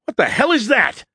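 high, loud startled shriek; high-pitched outburst of alarm. A narrator's reading. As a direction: shriek it in shocked disbelief. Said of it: "I shrieked".

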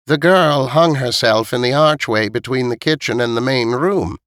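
put-on old woman setting the facts straight; matter-of-fact old woman. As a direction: tell the grim detail as an elderly neighbour. Voices old Missus Knight.

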